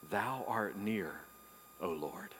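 hushed tender wonder; tender reverence. Acted. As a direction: linger on it lovingly. Savoring the psalm line.

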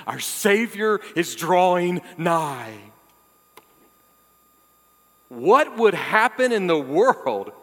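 loud, high, rousing sermon delivery; building fervent preaching; full-force preaching. Acted. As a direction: preach it out to the whole room.

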